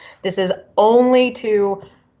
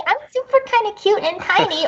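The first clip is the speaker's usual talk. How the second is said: high, tiny goblin voice; cute goblin; 12 semitones higher than usual. Shown as a demonstration. Player demos goblin voices for cohosts, cute goblin. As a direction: squeak it out like a cute little goblin. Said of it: "there's, like, a cute goblin voice".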